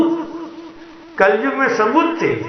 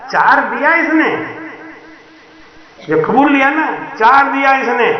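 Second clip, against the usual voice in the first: heated claim; 6 semitones higher than usual. Acted. Crowd arguing over coins.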